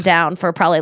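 ordinary delivery

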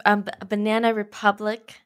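usual speaking voice

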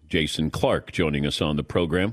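usual speaking voice

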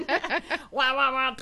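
high loud wah wah imitation; muffled wah wah talk. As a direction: mimic the muffled wah wah again. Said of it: "[name] just popped into the background".

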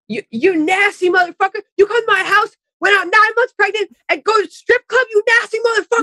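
lunge in his face and spit it at him; in-your-face rant. Retold or imitated. Imitating Catherine yelling at him in his face. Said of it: "lunging in and out, saying things, like, in his face".

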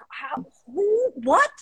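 high-pitched, sputtering bewilderment; stammered mock astonishment; baffled disbelief. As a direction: act out being utterly thrown, voice jumping up. Acting out her stunned reaction.

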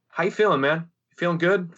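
mock-friendly joking, lifted pitch. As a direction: ask it high and kidding, tone hard to read.

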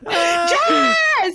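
high, loud mock shocked cry of the name; shocked shout. Cousins catching her with stolen biscuits, shocked cry. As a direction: yelp it like the cousins caught her.

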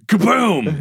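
high, punchy "kaboom"; collapse sound effect. Retold or imitated. Describing the ladder giving way, explosive crash sound.

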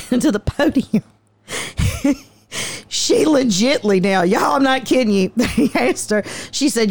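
usual speaking voice

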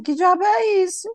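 put-on high, startled girl's retort, pitch shooting up; mock indignant girl. Girl on the beach snapping back at the boy, high and indignant. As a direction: play the girl who can't believe what she's seeing.

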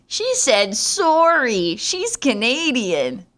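high, slow, knowing drawl; mock foreign listeners. Listeners abroad mocking her apology, slow and amused. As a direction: tease her like amused outsiders. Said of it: "international listeners are like".

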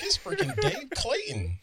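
high-pitched fan's gush, laughing; starstruck. Retold or imitated. Guest recalling first seeing the host, starstruck. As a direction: relive spotting your hero, pitch way up.

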